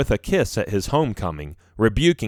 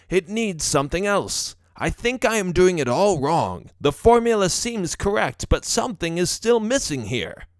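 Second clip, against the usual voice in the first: higher-pitched professor thinking aloud; puzzled professor; 7 semitones higher than usual. A narrator's reading. Professor troubled by his failing formula, puzzled. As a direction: puzzle over it in a raised professor voice.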